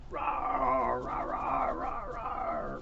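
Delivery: low, soft rawrs dropping to a breathy growl; a hushed beastly rumble for the kids; soft monster growls. Pretend. Monster roars during rumpus.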